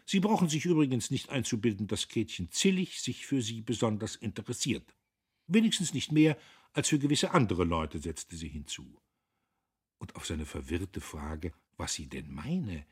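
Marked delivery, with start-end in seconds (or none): 0.1-8.0 higher, louder defiant retort. A narrator's reading. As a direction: put them in their place, voice raised in defiance. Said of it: "mit einer Art von Trotz".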